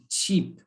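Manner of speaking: "sheep" begun with a hard ch, like "cheap"; mispronounced sheep. Retold or imitated. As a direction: say "sheep" with a t before the sh. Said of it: "do not use the T".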